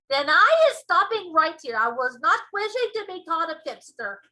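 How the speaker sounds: loud, hurt giant voice; stop, wounded and indignant; offended giant. Acted.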